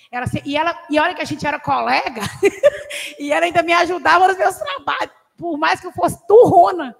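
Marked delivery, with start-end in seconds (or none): 2.3-3.1 high, airy burst of a laugh; real breathy giggle; breathless laugh. Real, not acted. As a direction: laugh it out silently. Laughs recalling old self.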